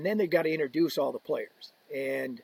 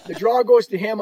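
ordinary talk, nothing marked

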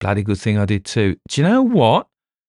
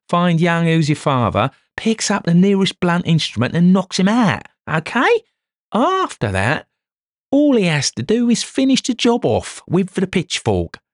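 much higher and louder, triumphant; gleeful bluster; 12 semitones higher, 3 dB louder than usual. Acted. Detective gleefully describing the murder to the inspector, much higher. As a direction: relish every step of the crime.

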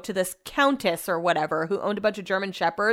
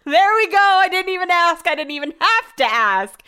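pitched way up and louder, slowly gloating; high exasperated I-told-you-so; 8 semitones higher, 6 dB louder than usual. Retold or imitated. Vlogger gloating at her husband, high and loud. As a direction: crow it like you knew he'd complain. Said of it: "I'm like".

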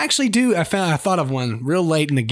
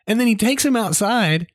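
ordinary delivery

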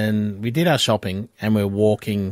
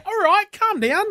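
much higher, teasing calm down; mock calming. Trev teases the excited fan, much higher. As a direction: settle her down with a laugh. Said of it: "And I was like".

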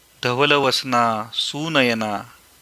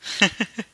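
light, high amused chuckle; a knowing little laugh; grandfather's teasing chuckle; short chuckle; 7 semitones higher than usual. Acted. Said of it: "आजोबा हसून म्हणाले".

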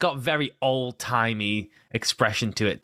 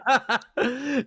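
high laugh; 11 semitones higher than usual. Real, not acted. Laugh at the guess.

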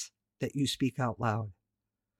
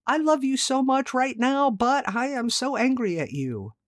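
upset mom; 8 semitones higher, 3 dB louder than usual. Retold or imitated. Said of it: "Did you ever hear her say something like".

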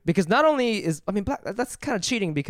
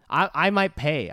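ordinary talk, nothing marked

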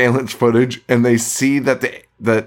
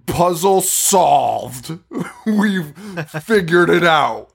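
drawn-out, hammed-up mock eureka; milk the sarcastic triumph, stretching it out; 6 semitones higher, much slower than usual. Podcaster mocking a doctor's leap to conclusions, mock triumph.

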